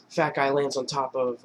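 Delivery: ordinary talk, nothing marked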